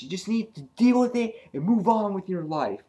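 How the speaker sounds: high-pitched retold scolding; heated urging. Andrea telling grieving Alan to move on, heated. Andrea argues back in acted quarrel.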